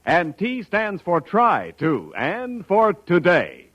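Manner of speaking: usual speaking voice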